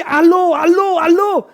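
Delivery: high, very loud repeated hello; retold shouting. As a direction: shout urgently into the phone over the music. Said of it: "Et il crie". (allô crié avec insistance)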